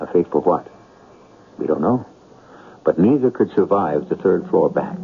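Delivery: ordinary delivery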